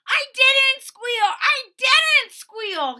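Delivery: very high, loud, slow protesting wail; desperate cry. Acted. Boland crying out to Tyrone that he never squealed, high and loud. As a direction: plead your innocence at the top of your voice. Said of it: "he cried".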